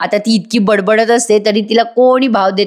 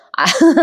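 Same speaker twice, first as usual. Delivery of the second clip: short high laugh; 5 semitones higher than usual. Real, not acted. Narrator laughs at Priya.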